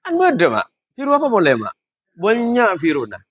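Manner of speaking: put-on high Madurese accent, indignant; old Madurese man. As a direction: protest in high village Madurese. (logat Madura tinggi, protes)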